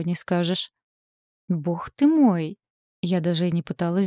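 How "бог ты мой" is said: slow, drawn-out amazed exclamation; stunned. Acted. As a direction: drag it out in disbelief. Girl stunned by huge armour.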